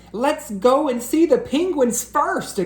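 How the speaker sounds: high eager plea; pulling at the teacher's sleeve; eager cry. Acted. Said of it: "cried Splat".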